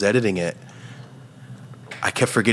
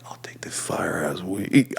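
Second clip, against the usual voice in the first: whisper; 6 dB quieter, slower than usual. Retold or imitated. Choosing the weed, whispered.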